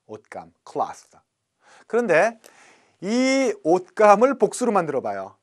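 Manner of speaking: normal talking voice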